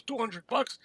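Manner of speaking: high-pitched retold disbelief; mock surprise, voice jumping up; incredulous question. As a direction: echo the offer as an astonished question. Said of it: "Then he goes".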